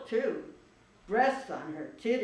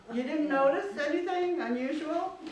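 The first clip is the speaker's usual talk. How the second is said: worried friend; 8 semitones higher, 4 dB louder than usual. Acted. Worried cat asks carefully.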